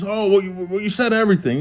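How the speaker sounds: high-pitched mock protest; confused protest. Cellmate protesting the guards' changed order, confused.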